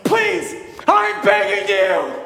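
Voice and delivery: high, loud, hammed-up pleading; desperate begging. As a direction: plead like a kid on his knees. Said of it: "He drops to his knees and goes".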